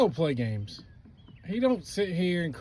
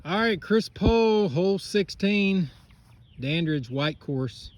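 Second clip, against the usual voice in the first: golf commentator; 4 semitones higher, 5 dB louder, slower than usual. Acted. Mock TV golf broadcast.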